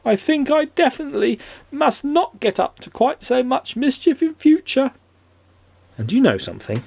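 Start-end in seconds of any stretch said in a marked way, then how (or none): 0.0-4.9 high, lightly voiced rueful imp; a small rueful trickster, high; high cheeky imp. A narrator's reading. Mister Mischief to himself, high rueful imp voice.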